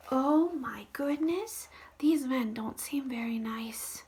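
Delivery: worried whisper; hushed and anxious. Real, not acted. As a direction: whisper it like a secret fear. Worried aside to child.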